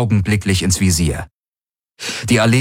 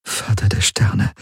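horrified whisper. A narrator's reading. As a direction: whisper in stunned awe. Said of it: "flüsterte Kirai bei dem Anblick". (entsetztes Flüstern)

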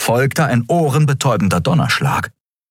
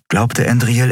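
ordinary delivery

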